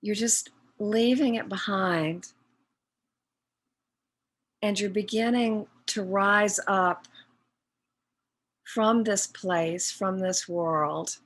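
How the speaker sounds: slow, measured meditation guide with long pauses; guided meditation. Real, not acted. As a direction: guide them inward phrase by phrase, leaving long silences.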